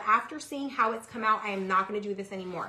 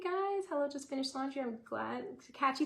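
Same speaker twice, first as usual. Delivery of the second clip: normal talking voice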